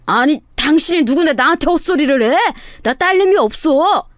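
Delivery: indignant denial with a touch of temper; frowning, annoyed question. A narrator's reading. Swindler disowning a supposed daughter, frowning and annoyed. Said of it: "미간을 찌푸리면서 물었습니다".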